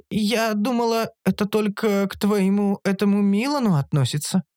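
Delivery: high-pitched, unruffled reply; unperturbed creature. A narrator's reading. Оша replying to the scolding, unperturbed. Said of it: "невозмутимо ответил голос".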